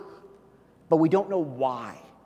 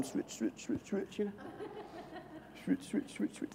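soft mimicked sound effect; 6 semitones higher, 12 dB quieter than usual. Imitating flipping through CDs.

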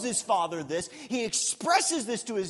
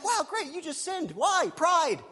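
sarcastic reply; 8 semitones higher than usual. Retold or imitated.

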